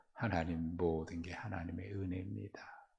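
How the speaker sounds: very soft, humble confession; hushed acting-out of grateful surrender; yielded prayer.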